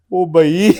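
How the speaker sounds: put-on Umar's Punjabi-accented exclamation. Impersonating Umar in a Punjabi accent, exclaiming. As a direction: mimic Umar's Punjabi lilt on ओ भाई. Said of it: "कहते".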